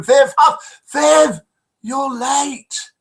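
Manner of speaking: high, loud sing-out of reproach; loud, high telling-off; calling out a latecomer. Real, not acted. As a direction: call the latecomer out across the room, pitched right up. Host calls out latecomer entering.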